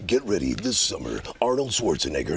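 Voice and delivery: deep dramatic trailer announcer; low trailer announcer voice; movie trailer voice. Retold or imitated. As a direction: boom it like a movie preview. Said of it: "Movie previews guy".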